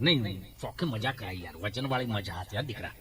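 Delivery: put-on old man pressing his praise; low insistent grandfather voice; old villager. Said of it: "મેં કીધું".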